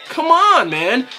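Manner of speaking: exasperated. Real, not acted. Fan yelling at play call.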